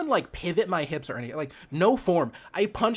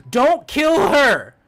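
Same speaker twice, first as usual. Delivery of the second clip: sudden loud, slow, high yell at the game; a real panicked shout, stretched out; yelling at the screen in alarm; 9 semitones higher, 11 dB louder, much slower than usual. Real, not acted.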